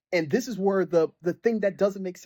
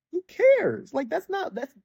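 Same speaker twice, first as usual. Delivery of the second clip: exasperated; 13 semitones higher, slower than usual. Real, not acted. Dismissing the game's writing.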